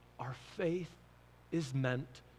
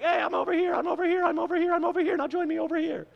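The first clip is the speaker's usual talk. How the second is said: high, louder mock calling out, waving people over; mocking caller; 11 semitones higher, 4 dB louder, faster than usual. Pastor mocking the doubt that nobody would join his church, high mock call. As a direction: ham up a guy begging people to come join him. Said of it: "The guy's like".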